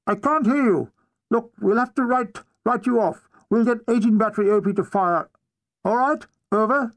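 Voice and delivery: commanding officer calling over a poor radio link; officer on field radio. Acted.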